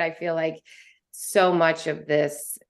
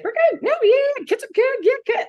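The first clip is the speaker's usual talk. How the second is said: much higher, airy surface chit-chat; mock breezy catch-up voice, pitched up; mock small talk; 5 dB louder, faster than usual. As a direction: parody shallow pleasantries, sing-song high. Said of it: "Like, it's like that".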